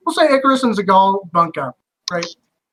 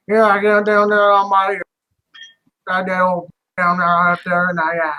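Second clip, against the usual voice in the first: lightly put-on Cajun accent, thick and mumbled. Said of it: "Cajun accent".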